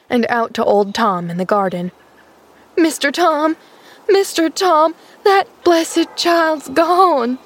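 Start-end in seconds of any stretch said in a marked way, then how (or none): 2.8-7.4 high, distraught wail; acted high-pitched lament. A narrator's reading. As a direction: wail the alarm, drawing out the last words. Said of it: "she wailed".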